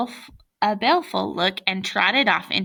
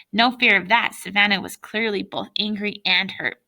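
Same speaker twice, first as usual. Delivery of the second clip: usual speaking voice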